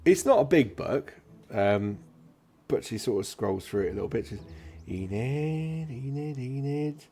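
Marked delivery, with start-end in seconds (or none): 4.9-7.0 searching records clerk. Acted. Clerk scanning a book for Enid, absently drawn out. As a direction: hum the name slowly while scanning.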